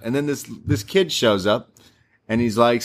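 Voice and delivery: ordinary talk, nothing marked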